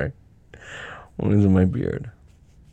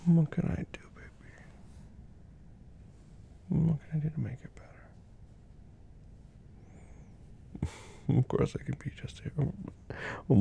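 whispering; 5 dB quieter than usual. Acted. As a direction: hush it close to her ear. Whispered comfort throughout.

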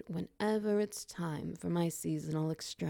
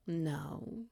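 normal talking voice

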